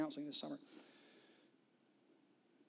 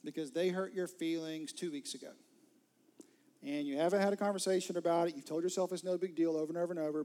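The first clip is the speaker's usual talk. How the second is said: normal talking voice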